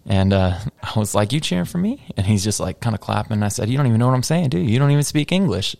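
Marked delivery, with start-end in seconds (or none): 1.3-2.1 much higher-pitched mock question; high, rising quoted question; retold incredulous question. Retold taunt of young fan. 3.7-5.7 higher-pitched mock scolding; playful quoted put-down, raised pitch; retold teasing jab. Retold ribbing of young fan.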